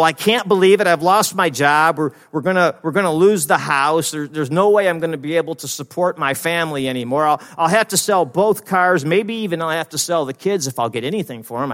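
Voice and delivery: loud, high, worked-up catastrophizing; acted panic. Breadwinner who lost the job fearing for house, acted panic. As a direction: spiral out loud, hammed-up distress.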